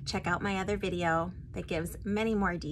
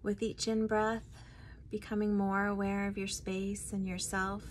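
guided meditation; 5 dB quieter than usual. Acted. Guide leading listeners to meet their spirit guide, guided meditation. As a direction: ease them inward, phrase by phrase, unhurried.

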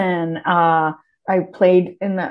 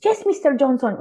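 higher, softer deferential reply; meek little higher-pitched servant voice; subservient stock maid line; 5 semitones higher, 5 dB quieter, faster than usual. Acted. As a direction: play the obedient maid answering the boss, softly. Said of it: "it was always like".

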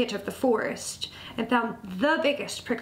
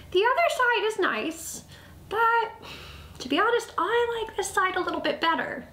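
high, squeaky mouse voice; tiny pitched-up critter; little mouse; 10 semitones higher than usual. A narrator's reading. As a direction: pipe up earnestly as a small mouse.

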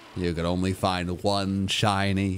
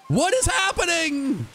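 high-pitched wail of disbelief; exaggerated, squealing bewilderment; hammed-up disbelief. Streamer exclaims in mock disbelief.

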